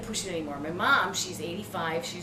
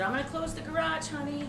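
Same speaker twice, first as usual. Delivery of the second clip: higher, softer motherly offer; lightly voiced mom; 7 semitones higher, 4 dB quieter than usual. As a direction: lightly mimic mom speaking high and soft. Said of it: "Mom said".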